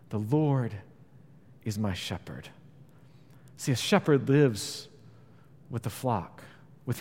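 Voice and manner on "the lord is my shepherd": intimate. Shown as a demonstration. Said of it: "this intimate and personal term".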